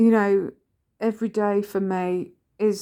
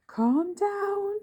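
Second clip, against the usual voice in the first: high, soft soothing voice; imitating son calming her down; son's soothing voice; 12 semitones higher, 4 dB quieter than usual. Son soothing his stressed mother, high and soft. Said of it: "this voice that's like".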